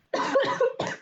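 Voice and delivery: coughing after straining the voice; hoarse throat-clearing cough; real coughing fit. Real, not acted. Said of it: "I need to stop with the Vernon voice".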